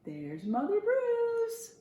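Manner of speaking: ordinary delivery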